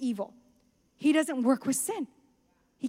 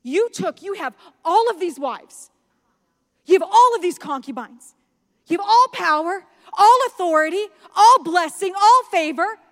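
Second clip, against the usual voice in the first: higher and louder, listing every gift; loud rebuke; 6 semitones higher, 9 dB louder than usual. Retold or imitated. Prophet rebuking the king, in a rising loud preach. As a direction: thunder it at him, each phrase louder.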